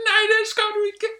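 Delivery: very high, shouted refusal; hammed-up screechy objection; Dronning Sonja. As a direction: protest as a shrieking comic queen. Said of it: "to meter fra dronning Sonja".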